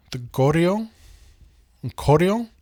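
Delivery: "Goryeo" then "Koryo", said slowly and set apart; careful name pronunciation. Shown as a demonstration. Lecturer sounding out the kingdom's name for students, carefully. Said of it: "sometimes written like this".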